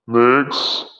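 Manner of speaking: ordinary talk, nothing marked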